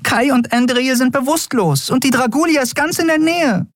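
very high-pitched helpless question; small creature at a loss, squeaky; desperate fairy. A narrator's reading. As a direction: plead in a tiny, panicky high voice. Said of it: "fragte sie verzweifelt".

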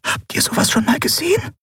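a little winged creature asking in awe; high, delicate fairy voice; tiny fairy voice. Acted. Tiny fairy Nelen speaks.

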